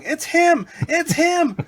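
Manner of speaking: high, loud imitated scream; mock panicked yell. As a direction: yell it as if fleeing, hammed up. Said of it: "runs away yelling".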